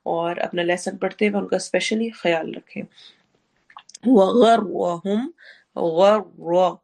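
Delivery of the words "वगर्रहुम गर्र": slow, drawn-out chanted Arabic with heavy r; Quran recitation. Shown as a demonstration. Teacher chanting a verse for her students, slow recitation. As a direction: recite slowly, dwelling on the heavy r. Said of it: "र heavy होगा".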